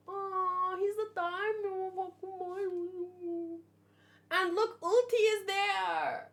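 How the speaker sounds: high, gushing excitement; delighted. Real, not acted. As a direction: coo over the cover. Excited at seeing cover.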